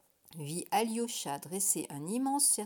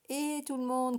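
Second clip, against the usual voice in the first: bright call across the tent, higher and louder; 6 semitones higher, 5 dB louder than usual. A narrator's reading. Vadim calling the whole circus, loud call. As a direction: shout to gather everyone. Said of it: "cria Vadim".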